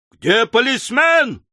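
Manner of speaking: high, drawn-out cry for help; slow, high-pitched narrated shout. A narrator's reading. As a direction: call out for the police. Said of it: "закричал мистер Снотгресс".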